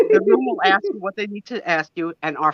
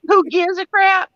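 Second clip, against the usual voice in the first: imitating Southern; 9 semitones higher than usual. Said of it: "I'm gonna use it".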